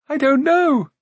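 very high, loud reply; guileless prince calling. Acted. Prince answering searcher in the dark, calling back. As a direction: call back high and artless.

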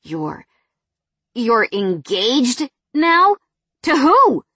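stammered then loud, high disbelief; stumble, then burst out in shock. A narrator's reading. Ex stunned by her engagement.

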